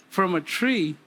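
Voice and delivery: ordinary talk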